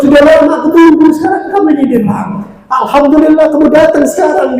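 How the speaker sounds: high, loud eager greeting; joyful welcome. Retold or imitated. Pious poor man greeting the angel of death, joyfully excited. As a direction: welcome him like a long-awaited guest.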